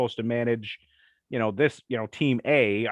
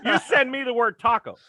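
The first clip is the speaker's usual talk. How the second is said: calling out; 10 semitones higher, 3 dB louder than usual. Acted. Calling out to a listener.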